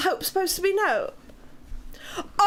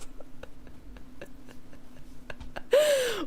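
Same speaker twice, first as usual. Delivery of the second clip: whispered laugh; 9 semitones higher, 6 dB quieter than usual. Real, not acted. Chat mocks her.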